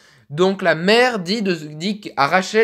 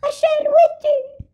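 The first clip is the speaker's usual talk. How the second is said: put-on much higher, thin old woman's voice; little old lady voice. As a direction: squeak it out like a tiny grandma. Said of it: "on va faire une petite voix de vieille".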